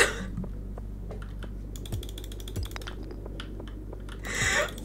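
long, soft, high wheeze of laughter; uncontrollable laughter. Real, not acted. Streamer blamed by chat breaks down, helpless wheezing laughter. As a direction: dissolve into silent, squeaky laughing. Cannot stop laughing at joke.

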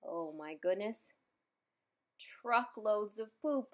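usual speaking voice

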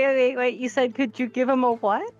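normal talking voice

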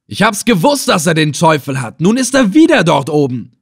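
high, loud agitated cries; screaming. Acted. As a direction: shout it in a frenzy. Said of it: "schrie Ibn Assel". (aufgeregt und laut schreien)

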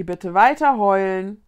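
loud all-clear call. Acted. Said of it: "rief Vicky".

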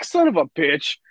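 a touch of hammed-up anger; mock mad curse. Retelling being cut from the play, mock angry curse. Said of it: "I was so fucking mad".

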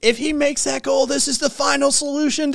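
high, excited mock commentator call; hammed-up sports commentator, high pitched. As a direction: mimic a football commentator getting carried away. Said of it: "I could see it coming".

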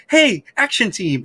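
high, perky mock call to the team; chirpy old lady. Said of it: "riding around a little bike saying".

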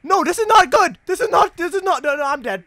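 high, loud panicked yelling; panicking gamer. Real, not acted. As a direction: panic as the water rises. Flood rising in game.

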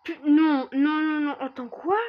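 loud, high panicked no no no; real fright breaking into cries; startled gamer yelling in alarm. Real, not acted. Panics at something new in game.

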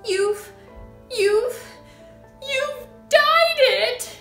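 stammered high shriek building to a peak; sputter, then screech the accusation. A narrator's reading. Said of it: "shrieked the mother".

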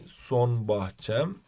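"Son bahçem" drawn out very slowly, syllable by syllable; slow spelled-out term. Shown as a demonstration. Teacher to students, slowly stressing the term. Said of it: "son bahçem dediğimiz ne".

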